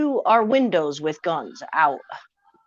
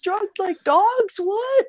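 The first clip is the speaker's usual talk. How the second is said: put-on much higher, slow confused child voice; kids confused; 13 semitones higher, 5 dB louder, slower than usual. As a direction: ask it like a puzzled little kid. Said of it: "my kids are like".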